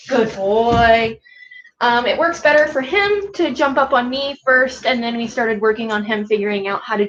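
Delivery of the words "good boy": dog praise. Real, not acted.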